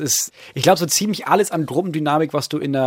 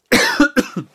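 cough; 12 semitones higher than usual. Real, not acted. S1 has something in throat.

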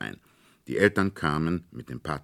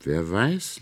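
earnest; 4 semitones higher than usual. A narrator's reading. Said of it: "sprach sehr ernst".

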